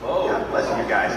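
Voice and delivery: normal talking voice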